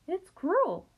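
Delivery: protesting. A narrator's reading. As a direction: blurt out the objection. Wilbur protesting.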